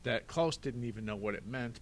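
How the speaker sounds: usual speaking voice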